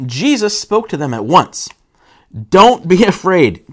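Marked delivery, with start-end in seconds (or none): 2.5-3.7 loud, slow, commanding call; acted booming command. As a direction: shout reassurance across the waves. Jesus calls to frightened disciples.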